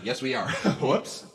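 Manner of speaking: ordinary delivery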